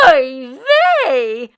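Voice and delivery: loud dismayed oy vey; dismayed old woman cry. Acted. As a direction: wail it like a fretting old woman. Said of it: "cried Meshka".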